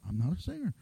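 hushed, sheepish excuse; mock quiet excuse. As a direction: mumble it so nobody hears. Muttered excuse to neighbour.

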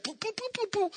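high, soft popping sound effects; hail noises. Retold or imitated. Preacher imitating hail falling around Israelites. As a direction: mimic hail plinking around.

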